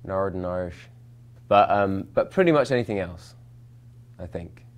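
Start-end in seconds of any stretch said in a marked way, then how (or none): 0.0-0.9 brief, shaky attempt at Northern Irish; Northern Irish lilt, tried out; Northern Irish accent. Shown as a demonstration. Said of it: "I struggle with Northern".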